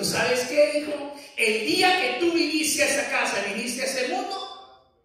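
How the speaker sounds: high, loud retold yell at son; retold shout at son. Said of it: "Y le digo".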